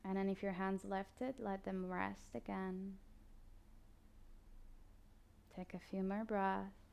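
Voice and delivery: soft, slow body-scan guidance with long pauses; guided meditation. Acted. Yoga teacher guiding listeners through a body scan, calm meditation voice. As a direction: ease the listener into stillness, unhurried.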